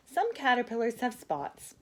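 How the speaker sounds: ordinary delivery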